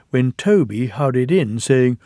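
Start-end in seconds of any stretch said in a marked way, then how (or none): none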